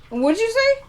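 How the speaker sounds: high, sudden question to someone across the room; calling off-camera. Real, not acted. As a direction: toss the question up high to your sister. Talking to sister off camera.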